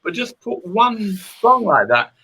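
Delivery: high, loud, pleading persuasion; animated retold insistence; emphatic urging. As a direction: press the point, pitched well up. Acting out urging the guitarist.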